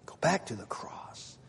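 soft unvoiced whisper. Shown as a demonstration. Preacher whispering the call back to the cross. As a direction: breathe the words to them. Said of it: "repeated whispered".

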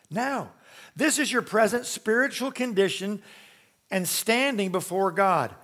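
ordinary delivery

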